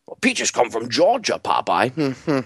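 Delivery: muttered know-it-all voice lightly put on; mock skeptic. Host voices a doubting listener in a mild mocking tone. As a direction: sneer it like a doubting caller.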